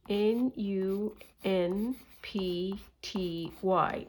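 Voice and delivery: slow letter-by-letter spelling. Shown as a demonstration. Vlogger spelling the word for viewers, slow letters. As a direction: spell each letter deliberately. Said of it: "I will write it out for you".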